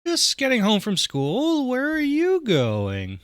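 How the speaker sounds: put-on high, slow teenage girl voice; high drawn-out daughter voice. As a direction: play the kid home from school. Player voices daughter Amanda.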